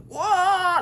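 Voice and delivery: squealed high and loud; high, loud squeal of a sick friend surprised by a gift; stunned what. Retold or imitated. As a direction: shriek of delighted disbelief.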